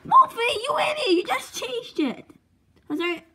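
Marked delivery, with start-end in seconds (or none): 0.0-2.2 much higher furious yell; angry shout. Acted. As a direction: scold him at the top of your voice. Friend ruined the name.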